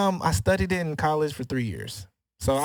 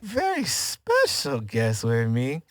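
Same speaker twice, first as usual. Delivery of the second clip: announcer build-up; 4 semitones higher, much slower than usual. Acted. Host announcing special guest, drawn out.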